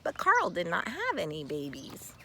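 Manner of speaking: ordinary delivery, nothing marked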